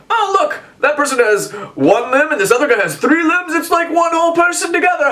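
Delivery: high, squeaky mock gawker voice; high jeering voice pointing at the couple; mocking onlooker. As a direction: play a gleeful jeering passerby. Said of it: "people would be like".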